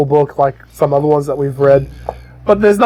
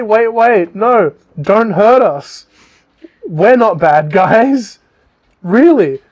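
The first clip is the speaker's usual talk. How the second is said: higher-pitched mock pleading, drawn out; pleading gnomes; 6 semitones higher, slower than usual. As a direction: plead like a cornered little gnome, slowly. Gnomes beg not to be hurt.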